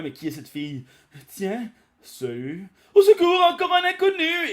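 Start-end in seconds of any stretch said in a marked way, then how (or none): 1.3-2.7 higher, slow, laid-back hello; casual greeting. Acted. Yamcha greets her. 2.9-4.5 very high, loud shriek; panicked girl scream. Acted.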